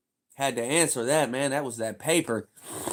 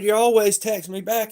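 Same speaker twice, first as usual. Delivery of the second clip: higher mock boss voice; a hint of the boss; 11 semitones higher, 6 dB louder than usual. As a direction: play the nagging boss. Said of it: "He said".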